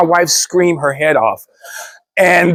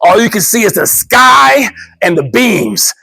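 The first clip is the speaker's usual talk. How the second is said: ordinary talk, nothing marked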